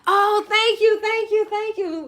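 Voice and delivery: high, singsong thank-yous; gushing thanks. Real, not acted. Host thanking a viewer for a compliment, high and gushing.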